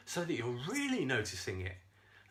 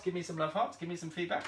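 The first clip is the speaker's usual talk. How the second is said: normal talking voice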